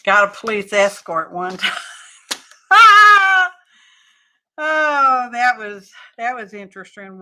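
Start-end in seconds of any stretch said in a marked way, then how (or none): 2.7-3.5 a loud, high burst of laughter. Real, not acted. Laughing at her own story.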